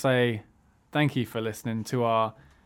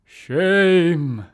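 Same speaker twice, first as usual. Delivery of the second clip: loud, higher mock shout; shout shame; 6 semitones higher, 8 dB louder than usual. Shown as a demonstration. Host showing how he'd shout shame at someone, loud. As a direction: bellow the word like a public shaming. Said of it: "I would love to just shout".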